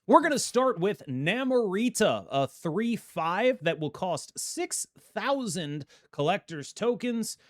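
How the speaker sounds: slow, drawn-out announcer read. Acted. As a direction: announce it like a show host. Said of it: "I'm talking like an announcer".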